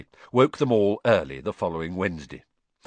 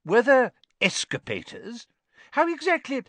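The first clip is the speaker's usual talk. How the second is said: much higher, thrilled questions; higher excited questions; eager; 4 dB louder than usual. A narrator's reading. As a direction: gush over muggle gadgets. Mr Weasley fascinated by muggles.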